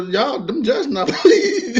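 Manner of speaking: warning friends while cracking up; laughing. Real, not acted. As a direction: laugh through it.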